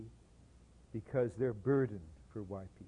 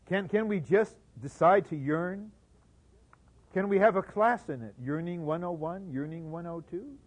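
mocking; 7 semitones higher, 9 dB louder than usual. Mocking the idea of yearning class.

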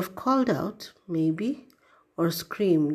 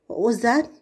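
higher, louder, sharp query; pitched-up "what just happened?" alarm; startled question; 6 semitones higher, 6 dB louder, faster than usual. A narrator's reading. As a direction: ask in raised-voice surprise. Counselor startled by thrown lamp.

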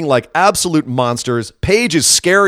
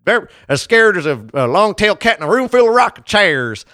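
mocking fake Southern accent; 4 semitones higher than usual. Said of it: "put on this horrible accent".

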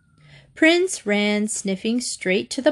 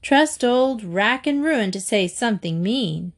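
ordinary delivery

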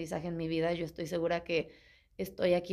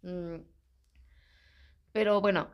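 ordinary delivery

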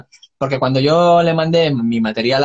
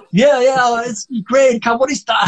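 much higher, enthusiastic mock Norwegian accent; Norwegian-accented English; 9 semitones higher, slower than usual. Singer quotes the manager praising his song, high Norwegian accent. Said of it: "con su inglés con acento noruego".

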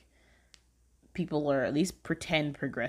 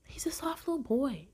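doting whisper; 10 semitones higher than usual. Real, not acted. Gushing about a sweet character.

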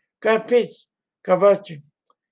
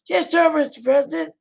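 much higher, eager yes sir to a superior; eager young soldier; 10 semitones higher than usual. Pretend. Private answers President Eisenhower on the phone, high eager voice. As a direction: snap to attention with a keen high reply.

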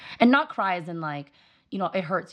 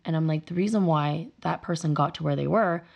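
usual speaking voice

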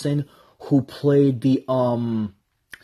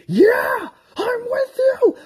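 high shouted cheer; fired-up cheer; 4 dB louder, slower than usual. Retold or imitated. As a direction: yell along with the hero. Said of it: "and you're like".

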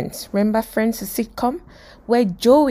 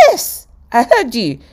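louder, higher acted retort; insistent reply; 5 semitones higher, 5 dB louder than usual. Joey answering his French teacher, insistent. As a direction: insist that you were listening.

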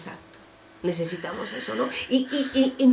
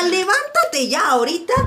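higher, louder scolding; urgent rousing command; 8 semitones higher, 5 dB louder than usual. Acted. God rousing Elijah under the tree, urgent scolding. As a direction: order him up right now.

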